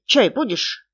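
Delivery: ordinary delivery, nothing marked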